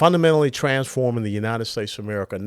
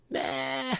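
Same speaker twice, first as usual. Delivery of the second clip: high, soft, drawn-out scoff; mock dismissal; 8 semitones higher, 8 dB quieter than usual. Host mimicking the left brushing off a creator God, mock dismissal. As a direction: wave it off in a high, mocking voice.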